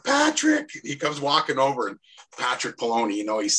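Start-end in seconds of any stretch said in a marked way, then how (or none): 0.0-0.7 high-pitched call across the room; retold yell. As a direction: sing out the name high, imitating a yell. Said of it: "He yells over".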